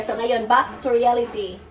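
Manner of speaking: usual speaking voice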